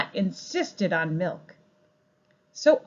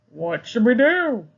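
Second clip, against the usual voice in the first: louder, puzzled farmer voice; 2 semitones higher, 8 dB louder than usual. Acted. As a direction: scratch your head as the farmer. Farmer asks.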